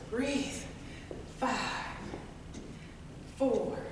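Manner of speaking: ordinary talk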